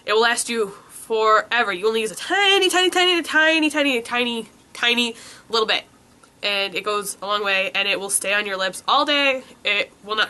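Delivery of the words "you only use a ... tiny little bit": each tiny higher and stretched, peaking mid-run. Real, not acted. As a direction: stress every tiny, climbing up high then easing down. Emphasising how little is needed.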